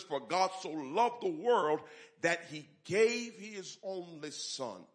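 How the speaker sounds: slow, solemn verse; scripture quote. Acted. Pastor quoting the verse to the church, slow and solemn. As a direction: lean into each word of the verse.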